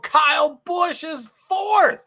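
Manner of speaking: high, loud, drawn-out announcement; excited shout-out. Real, not acted. Excited news of a race position.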